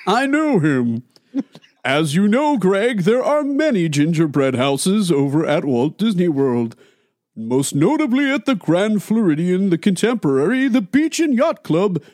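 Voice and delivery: lightly voiced jolly Santa; easy, kindly Santa Claus. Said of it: "the Santa Claus voice".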